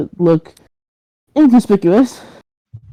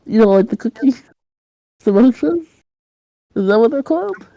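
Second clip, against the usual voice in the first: higher-pitched, awkward, rambling reassurance; flustered reassurance; 6 semitones higher than usual. Acted. Kidnapper awkwardly offering his captive a cookie, flustered reassurance. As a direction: stumble through an awkward peace offering.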